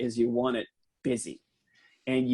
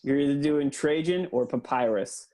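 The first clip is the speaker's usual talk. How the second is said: ordinary talk, nothing marked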